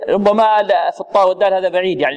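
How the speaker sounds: usual speaking voice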